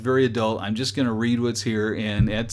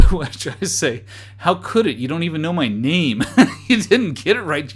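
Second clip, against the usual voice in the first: laughing reply; 5 semitones higher, 6 dB louder than usual. Real, not acted. Laughing at the scam message.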